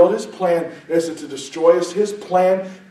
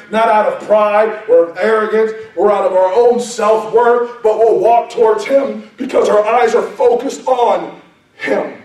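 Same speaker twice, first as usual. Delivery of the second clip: louder, higher, building sermon delivery; impassioned preaching; 4 semitones higher, 6 dB louder than usual. Real, not acted. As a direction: preach it with mounting fervour. Preaching at rising force.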